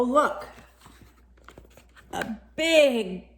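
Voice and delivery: plain reading voice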